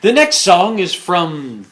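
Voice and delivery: upbeat announcer, a bit high; radio DJ voice. Pretend. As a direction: introduce it like a DJ. Mock DJ announcing next song.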